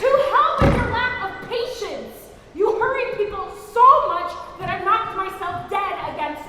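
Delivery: loud, high scolding complaint; angry retort. Acted. Servant blaming master for her knocked head, angry. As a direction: fire back loudly at the impatience.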